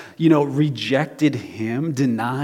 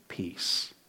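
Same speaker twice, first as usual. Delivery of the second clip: very soft, low, near-whispered word; hushed reassurance; 8 semitones lower, 13 dB quieter than usual. Retold or imitated. Jesus to all the disciples, hushed and calming. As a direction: let it drop to a gentle hush.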